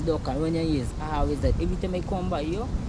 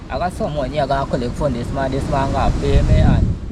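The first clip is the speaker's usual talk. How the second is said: loud complaint; 2 semitones lower, 8 dB louder than usual. Acted. Loud complaint about debt.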